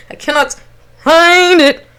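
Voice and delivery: slow, rising strained wail; drawn-out exasperated cry; frustrated outburst. Retold or imitated. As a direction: stretch FIND out high and long. Frustrated outburst, second time louder.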